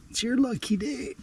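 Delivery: soft high sing-song to a fish; playful high coo. Pretend. Angler teasing the bass with its luck, high and playful. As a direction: tease it softly up high.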